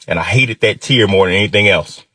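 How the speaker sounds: plain reading voice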